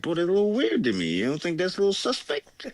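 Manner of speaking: fast, high, wary protest; retold disbelief, quick and high; mock suspicion. Retelling his wary reply.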